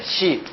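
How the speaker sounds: 시 as Japanese shi; teacher's isolated model of Japanese 시; Japanese pronunciation. Shown as a demonstration. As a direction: repeat 시 clearly with a Japanese sh sound. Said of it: "요거는 일본말로 발음이".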